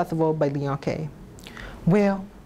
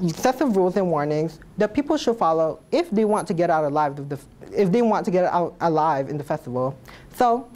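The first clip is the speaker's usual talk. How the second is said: normal talking voice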